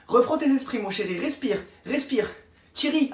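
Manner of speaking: ordinary talk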